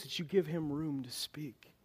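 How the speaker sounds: soft pulpit whisper. Real, not acted. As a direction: whisper it like a secret. Preacher drops to a whisper.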